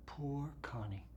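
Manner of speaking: soft breathless "Poor Connie"; whispered pity. Acted. Pitying aside.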